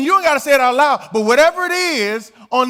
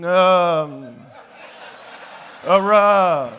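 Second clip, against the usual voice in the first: low, drawn-out preacher voice; slow churchy drawl, lightly mocking; mock old-time preacher; 7 semitones lower, much slower than usual. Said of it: "They would go".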